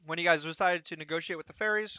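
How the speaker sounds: talking between bites; eating chicken. Real, not acted. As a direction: mouth full of chicken. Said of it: "sorry, I'm eating chicken".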